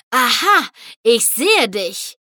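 triumphant, loud and slowing on the second half; triumphant call. A narrator's reading. Rainbow spots the red smoke and calls out triumphantly. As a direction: crow it out in triumph. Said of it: "rief Rainbow triumphierend".

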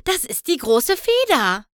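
a breathless cry of discovery; excited. A narrator's reading. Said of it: "keuchte die Prinzessin".

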